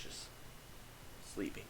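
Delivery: whispered, drifting into sleep; drowsy whisper. Retold or imitated. Narrator finally falling asleep in the motel, whispering. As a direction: breathe the word out as sleep takes over.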